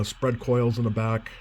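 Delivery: normal talking voice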